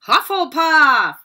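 the hat bellows the house name; hat's shout. Acted. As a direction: stretch the house name out grandly.

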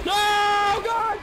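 high, loud scream of panic; panicked shout. Real, not acted. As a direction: yell out in sudden fright. Horror game jumpscare panic.

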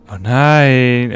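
alarmed cry. Real, not acted. Sudden danger in the game.

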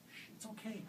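soft hushing comfort; soothing. A narrator's reading. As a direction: hush her gently. Mother soothes crying child.

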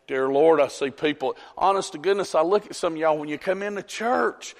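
usual speaking voice

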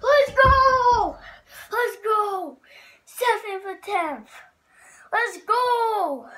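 loud, high-pitched victory cheer; triumphant shouting. Real, not acted. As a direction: shout it out, thrilled to finally make it. Celebrates making the shot.

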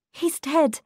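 high, shocked cry of news; high-voiced young woman in shock. A narrator's reading. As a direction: blurt it out in shock, pitched high. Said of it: "could not stop shaking".

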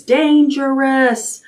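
high, drawn-out warning cry; buzz screaming. A narrator's reading. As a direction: stretch out the warning, pitched high. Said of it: "the buzz screams".

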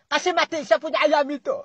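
ordinary delivery

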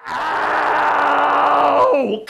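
high cry of pain. Real, not acted. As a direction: yelp as it tightens. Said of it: "I'll be quiet".

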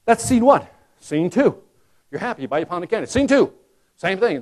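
ordinary delivery

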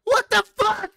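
high shrieking laughter-panic; screaming at a spider. Real, not acted. Spider startles them.

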